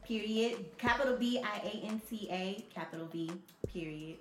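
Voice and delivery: dictating. Real, not acted. Spelling out her Snapchat handle.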